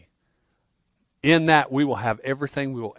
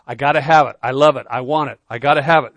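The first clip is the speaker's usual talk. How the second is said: craving voice; 9 dB louder than usual. Acted. Voicing worldly craving.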